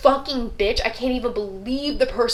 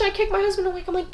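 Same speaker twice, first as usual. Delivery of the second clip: ordinary delivery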